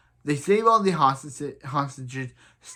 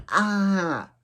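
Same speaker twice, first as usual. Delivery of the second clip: shocked cry; 4 semitones higher than usual. Real, not acted. Shocked at the reveal.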